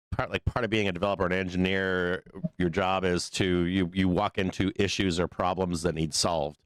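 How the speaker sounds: ordinary talk, nothing marked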